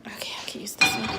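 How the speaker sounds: murmured to herself in the kitchen; whisper. Real, not acted. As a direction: breathe it out under her breath. Whispering while searching pans.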